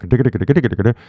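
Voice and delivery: rapid nonsense "digga digga" stutter; imitated gibberish. Baffled learner hears fast English, rapid imitated gibberish. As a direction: imitate speech heard as a meaningless rattle. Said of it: "And you're like".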